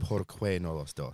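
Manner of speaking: slow Spanish accent, rolled R in "Por". Shown as a demonstration. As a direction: say "Por qué no los dos?" slowly with a rolled R. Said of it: "Like that".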